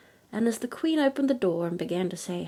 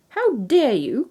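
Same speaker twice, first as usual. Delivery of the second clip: slow, loud, outraged; snake-bonnet fairy outraged at the king; cried indignantly; 5 semitones higher, 7 dB louder, slower than usual. A narrator's reading. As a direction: spit it out slow and furious. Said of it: "cried the fairy in the bonnet".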